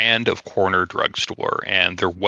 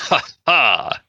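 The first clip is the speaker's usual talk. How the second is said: short, higher mocking laugh; haughty laugh; 6 semitones higher than usual. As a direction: scoff out a smug laugh. Haughty laugh after jab.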